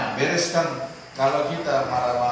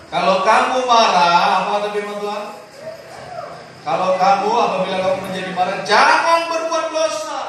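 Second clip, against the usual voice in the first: loud, higher preacher's delivery; preaching at full force; 6 semitones higher, 6 dB louder than usual. Acted. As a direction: hammer the warning to the congregation. Preaching on anger. (khotbah berapi-api)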